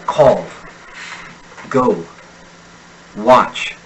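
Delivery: each verb said with a firm falling pitch; falling stress. Shown as a demonstration. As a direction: drop the pitch hard on the command verb. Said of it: "the stressed syllable to descend in pitch".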